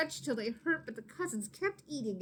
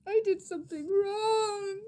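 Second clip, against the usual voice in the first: high, louder tearful sob; sobbing; 11 semitones higher, 6 dB louder than usual. Acted. Maria confessing to her mama, sobbing. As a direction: cry it out in shame. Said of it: "Maria sobbed".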